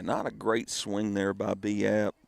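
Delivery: ordinary talk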